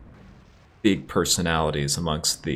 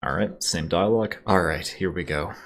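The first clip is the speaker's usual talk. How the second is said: ordinary talk, nothing marked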